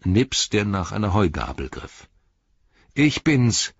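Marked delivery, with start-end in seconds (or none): 2.9-3.7 high, bright hail; called out. A narrator's reading. Returning hobbit announcing himself at the farmhouse, raised calling voice. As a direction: hail them as you ride up. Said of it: "rief Sam".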